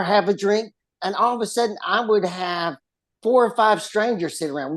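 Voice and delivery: normal talking voice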